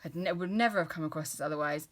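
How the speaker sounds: usual speaking voice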